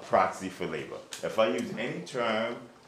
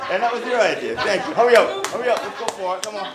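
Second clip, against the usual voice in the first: drill sergeant; 10 semitones higher, 11 dB louder than usual. Real, not acted. Teacher driving push-ups, loud.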